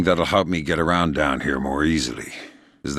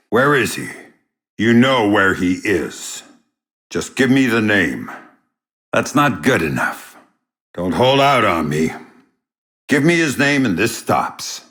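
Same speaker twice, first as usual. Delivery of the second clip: hard, loud demand, pushing higher; angry interrogation; 4 semitones higher, 4 dB louder than usual. Acted. Spy interrogating a captive for a name, angry and loud. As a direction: lean on him until he breaks.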